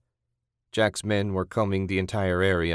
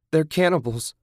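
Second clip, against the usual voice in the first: high, louder burst of shock; exclaiming; 8 semitones higher, 4 dB louder than usual. A narrator's reading. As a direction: blurt it out in horror. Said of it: "Julie exclaimed".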